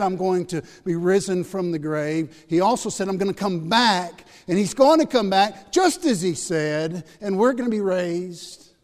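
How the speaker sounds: full-force preaching. Acted. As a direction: proclaim the promise to the room. Preacher quoting Jesus' promise.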